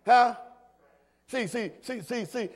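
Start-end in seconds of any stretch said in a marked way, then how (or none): none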